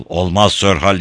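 ordinary delivery

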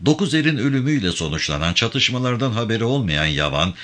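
ordinary talk

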